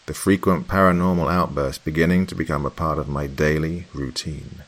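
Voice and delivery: usual speaking voice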